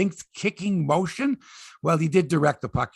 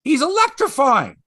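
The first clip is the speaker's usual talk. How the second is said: higher and louder, savouring the word; excited emphasis; 9 semitones higher, 6 dB louder than usual. Real, not acted. Host praising McDavid, repeating the word with excitement. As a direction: land the word with real excitement.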